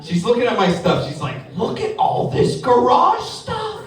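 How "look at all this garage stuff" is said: put-on high, slow sneering girlfriend; disdainful wife. Girlfriend sneering at his stuff, slow and high. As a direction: look down your nose at it.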